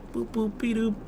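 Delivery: high squeaky cartoon boop; Betty Boop. Shown as a demonstration. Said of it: "I can't do it".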